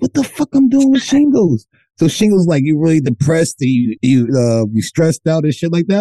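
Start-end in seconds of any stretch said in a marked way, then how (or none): 0.0-1.7 pitched way up in stunned disbelief; shocked disbelief. Retold or imitated. Man reading shingles on Google, shocked high outburst. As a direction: yelp it out like the screen just betrayed you.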